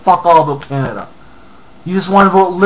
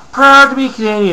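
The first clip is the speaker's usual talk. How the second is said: mocking quote; 9 semitones higher, 6 dB louder than usual. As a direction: read out high and loud with scorn. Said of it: "another fucking idiot".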